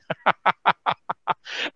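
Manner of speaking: real voiceless chuckle; amused laugh. Real, not acted. Host teasing a panelist's serious look, amused laughter. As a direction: laugh at your own teasing remark.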